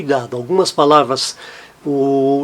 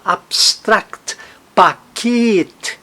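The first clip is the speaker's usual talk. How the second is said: "Abstract, paquete", as ab-STRACT, pa-KET; stress on last syllable; 4 semitones higher, much slower than usual. Shown as a demonstration. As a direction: land on the end of "Abstract" and "paquete". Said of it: "a sílaba tônica no final".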